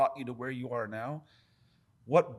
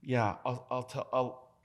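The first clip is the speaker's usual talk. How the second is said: usual speaking voice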